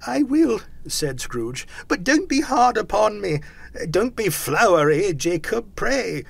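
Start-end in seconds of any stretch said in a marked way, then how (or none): 0.1-0.6 high, loud, hasty assent; frightened Scrooge. A narrator's reading. Scrooge promising to hear the ghost, frightened. 1.9-6.2 high, loud entreaty; frightened pleading. A narrator's reading. Scrooge begging Jacob not to be hard, pleading.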